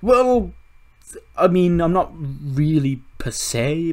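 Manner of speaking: very high, loud, slow voice; high, loud, hesitant voice; put-on dinosaur voice. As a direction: squeak it out hesitantly. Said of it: "he's like".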